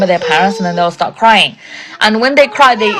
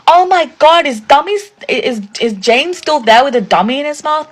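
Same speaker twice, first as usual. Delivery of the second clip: high-pitched imitation of public shaming; mock loud outrage; 6 semitones higher than usual. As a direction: exclaim it high, for everyone to hear. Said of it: "say it out loud".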